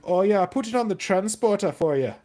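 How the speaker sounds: loud, high-pitched mock Welsh accent; Welsh accent impression. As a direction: imitate a Welsh shopkeeper on the phone, big and loud. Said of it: "he sounded like he had a Rastafarian accent".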